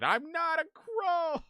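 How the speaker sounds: high, slow protest; indignant squeak. Real, not acted. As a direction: squeak it out, offended. Protesting chat's crow remark.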